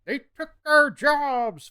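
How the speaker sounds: loud, high, drawn-out cartoon yell; South Park yokel. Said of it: "South Park".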